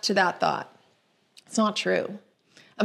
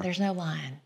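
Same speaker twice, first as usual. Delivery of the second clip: soft spooky voice; 2 semitones lower, 7 dB quieter than usual. Acted. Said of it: "maybe it was a little bit of that".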